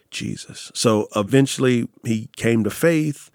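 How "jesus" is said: whispered. Retold or imitated. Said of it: "And he goes".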